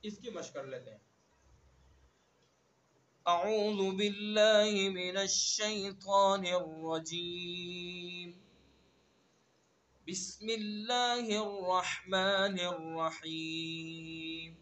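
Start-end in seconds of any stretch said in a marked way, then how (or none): 3.3-14.5 very slow, drawn-out chanted recitation; tajweed chant; Quranic recitation. Acted. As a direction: recite solemnly, stretching every vowel. Begins recitation.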